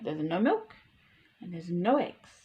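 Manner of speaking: ordinary delivery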